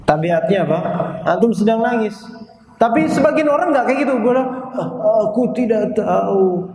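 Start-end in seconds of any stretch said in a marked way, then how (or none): 4.7-6.7 slow, lightly voiced sick person; sounding sick. Shown as a demonstration. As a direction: say it weakly and slowly, as if ill. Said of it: "Itu menunjukkan dia sedang sakit". (suara orang sakit yang lemah dan pelan)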